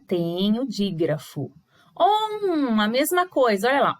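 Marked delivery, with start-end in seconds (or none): 2.0-2.8 "On" agudo e prolongado pelo nariz; som nasal. Shown as a demonstration. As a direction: sustente "On" como um O nasal. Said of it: "On é o som nasal". ("On" nasal, esticado como um zumbido)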